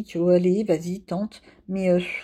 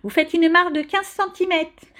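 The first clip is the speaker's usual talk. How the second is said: une institutrice, plus aiguë, légèrement caricaturée; imitation de maîtresse; 9 semitones higher than usual. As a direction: singer la maîtresse d'école qui fait la leçon. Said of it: "j'ai l'impression d'être encore à l'école". (pour les abonnés, imite la maîtresse qui impose une marge, voix plus aiguë)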